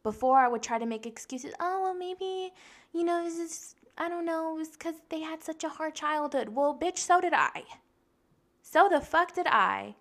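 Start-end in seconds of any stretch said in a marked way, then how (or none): 1.6-6.5 high, thin excuse-making voice; mock your old self making excuses, pitched way up; whiny-high imitation of making excuses. Mocks her own past excuses. 6.5-10.0 indignant comeback. Retold or imitated. Snaps back at the excuse.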